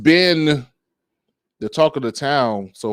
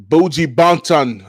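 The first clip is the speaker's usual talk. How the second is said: Jamaican accent; 4 semitones higher, 5 dB louder than usual. Shown as a demonstration. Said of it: "I gotta say it in the accent".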